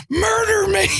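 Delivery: high, crying mock scream; retold scream. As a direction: wail it like a friend in agony hanging out of the car. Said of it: "Your feet out of the car screaming".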